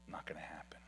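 breathy whisper, barely audible; whispered aside. Acted. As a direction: drop to a whisper to land the point.

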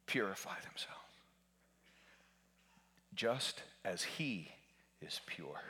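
whispered scripture. Acted. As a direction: breathe the verse out softly.

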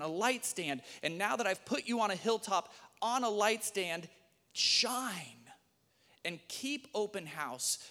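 rising, impassioned reading of Jesus' words; dramatic scripture reading. Acted. Pastor reading Matthew five to the congregation, impassioned. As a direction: proclaim it like a preacher building up.

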